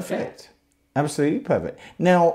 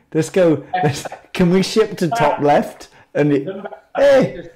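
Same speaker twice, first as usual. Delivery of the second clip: excited shout; 6 semitones higher, 6 dB louder than usual. Real, not acted. Joking excitement about shipping.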